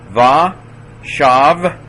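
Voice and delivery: va, shav, high and loud with a gap between; teacher's ringing breakdown of va, shav; syllable by syllable. Shown as a demonstration. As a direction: sound out va, shav as two separate syllables. Teacher sounds word out by syllables.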